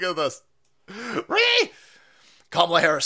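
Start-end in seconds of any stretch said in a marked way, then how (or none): 1.3-1.7 high shrill mock ree screech; mock shriek. Mocking the left with a meme screech.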